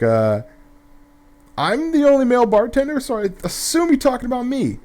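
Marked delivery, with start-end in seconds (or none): 1.6-4.8 much higher, dry mock-polite voice; imitating the bartender. Bartender answering the angry lady, high smug mock voice. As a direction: pipe up like a smug bartender owning it. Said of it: "And Greg's like".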